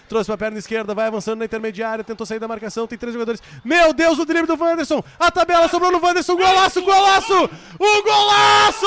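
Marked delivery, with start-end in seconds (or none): 3.6-8.9 shouted, higher, louder goal cry; explosive goal commentary. Real, not acted. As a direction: scream golaço at full force. Goal call climax.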